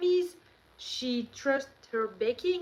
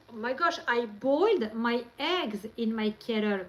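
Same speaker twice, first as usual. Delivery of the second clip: ordinary talk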